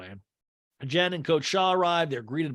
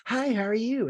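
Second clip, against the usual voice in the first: high imitated hello; gushing greeting; 8 semitones higher than usual. Meredith greets Angie K, imitated high and gushing. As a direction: pitch the hello up, lilting. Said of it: "Mary's like".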